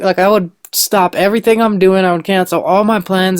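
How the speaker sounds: ordinary talk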